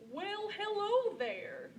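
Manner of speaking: slow, high, loud Southern drawl for hello; southern twang. Retold or imitated. Grandfather greets grandchild on the phone, Southern accent. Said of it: "he said in his southern twang".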